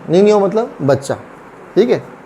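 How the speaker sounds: ordinary delivery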